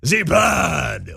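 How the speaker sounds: hammed-up French accent, called out; mock French accent. Host mocking Harris speaking to the French, hammed-up French accent. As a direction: bark it in a badly faked French accent. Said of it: "by far the French accent".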